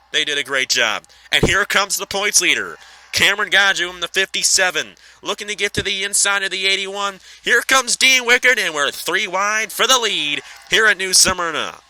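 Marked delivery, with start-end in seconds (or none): 1.3-7.2 play-by-play. Acted. Live sim race commentary. 7.4-11.8 high, loud, excited shouting; excited race call. Real, not acted. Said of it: "I'm trying to get as excited as I can".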